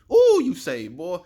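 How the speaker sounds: high loud joyful ooh; excited cheer. Real, not acted. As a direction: celebrate the viewer out loud. Cheering viewer after saying word.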